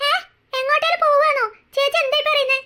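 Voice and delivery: the little sister can't believe it; alarmed younger sister; shocked. Acted. Sister hears she'll leave.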